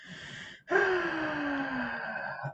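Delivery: long drawn-out sigh; long high sigh. Real, not acted. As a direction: let out a long weary sigh.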